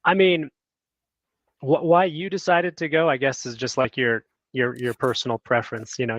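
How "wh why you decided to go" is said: ordinary talk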